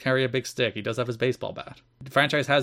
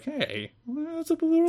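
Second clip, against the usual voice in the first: high mock voice; slower than usual. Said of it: "We're like".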